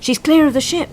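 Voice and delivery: woman on the beach shouts to the others; high anxious shout; worried shout. A narrator's reading. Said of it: "shouted a worried woman".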